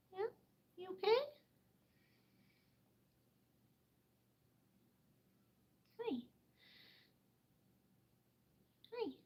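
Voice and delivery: soft high-pitched coo to a pet. Real, not acted. Checking on the bird.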